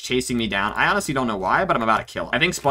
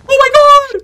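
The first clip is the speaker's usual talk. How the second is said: high, loud shriek of shock; shocked scream; 13 dB louder than usual. Real, not acted. Losing the game round to the enemy, a real shocked scream. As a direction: scream as the game is suddenly lost.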